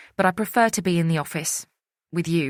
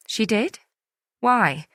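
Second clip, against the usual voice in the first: defensive; 5 semitones higher, 4 dB louder, slower than usual. A narrator's reading. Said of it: "I sound defensive".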